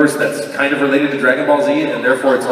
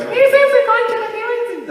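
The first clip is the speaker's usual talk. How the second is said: much higher, slower squeaky text-reading voice; high put-on friend voice; Monica's voice; slower than usual. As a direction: read the text as she would say it. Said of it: "I always read her text in her voice".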